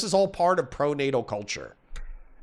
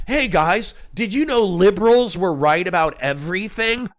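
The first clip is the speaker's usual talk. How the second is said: higher, louder sarcastic fake cheer; hammed-up gushing convert, louder than usual; mock sellout announcement; 5 semitones higher, 4 dB louder than usual. Pretending to tell his audience liberals were right, in mocking fake enthusiasm. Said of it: "to be like".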